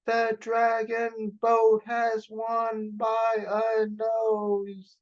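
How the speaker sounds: announcing. Acted. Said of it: "the Emperor announced".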